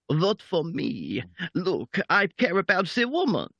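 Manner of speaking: put-on a quick jokey French-accented Macron voice. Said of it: "outdo Ben on the French Macron accent".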